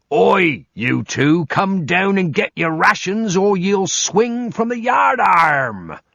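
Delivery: put-on high, slow, bellowing old sea bosun; bosun shouting orders. Bosun yelling at the two men to fetch rations, hammed-up shout. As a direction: roar it across the deck like a bosun.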